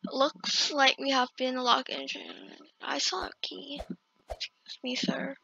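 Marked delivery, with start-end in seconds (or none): 2.8-5.4 soft sneaky whisper. Real, not acted. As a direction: tiptoe past him, hushed. Sneaking past the dentist.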